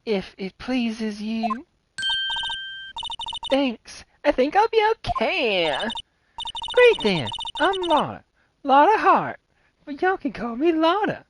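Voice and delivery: chirpy country gal perking up at a murder; Southern Lotta voice. Acted. Lotta hears about a murder, high eager Southern twang.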